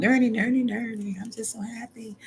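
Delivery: high, sing-song giddy voice; mock a person skipping along in glee; giddy happy person. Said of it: "Some people are gonna be walking around".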